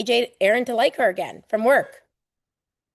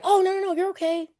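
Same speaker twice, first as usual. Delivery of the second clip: put-on high, sing-song reassurance to a crying child; a parent hushing a crying kid, soothing; mock soothing parent; 10 semitones higher than usual.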